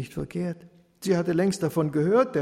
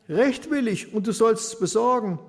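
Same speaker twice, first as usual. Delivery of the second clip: demanding widow; 7 semitones higher, 5 dB louder than usual. Acted.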